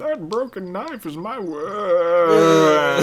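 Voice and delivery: giant's voice. Retold or imitated. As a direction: stretch each word like a huge lumbering giant. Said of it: "That's what he sounds like".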